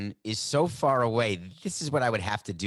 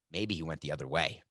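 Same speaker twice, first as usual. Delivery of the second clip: ordinary talk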